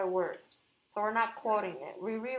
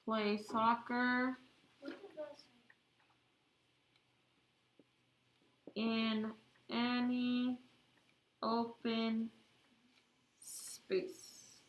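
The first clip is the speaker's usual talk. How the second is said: very slow dictation, one word at a time; dictating; 5 dB quieter, much slower than usual. Real, not acted. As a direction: spell it out slowly for note-takers. I hope you get this all down before I erase.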